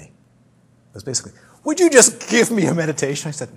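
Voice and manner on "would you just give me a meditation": loud, high imitation of a frustrated student; mock outburst, pushing hard on the plea; exasperated demand. Lady struggling with teacher over a meditation, imitated exasperated demand. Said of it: "So she struggled with me".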